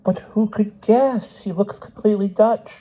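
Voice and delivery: high, drawn-out protest; protesting doctor. A narrator's reading. Doctor protests she could not know.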